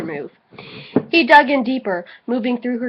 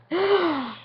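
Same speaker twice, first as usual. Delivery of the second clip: dramatic reveal; 7 semitones higher, 4 dB quieter than usual. Acted. Reveal word after whisper.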